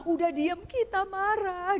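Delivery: high, slow, half-cried protest; mock tearful anger; mock angry wife. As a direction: play the wife crying in anger. Retelling angry crying wife.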